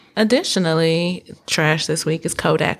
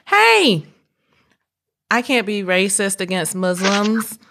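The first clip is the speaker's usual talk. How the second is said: imitated defensive call. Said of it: "Then she pulled out the".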